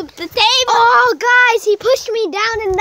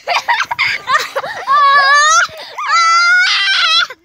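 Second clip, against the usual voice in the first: loud laughing scream; 10 semitones higher, 4 dB louder than usual. Real, not acted. Kids chasing, loud laugh.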